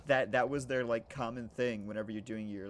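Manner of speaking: normal talking voice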